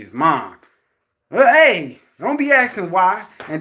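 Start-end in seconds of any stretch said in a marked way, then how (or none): 1.3-3.2 higher and louder snap; a light impression of a stern mother; mock scolding mom. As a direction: play the mother cutting him off. Mom voiced scolding son.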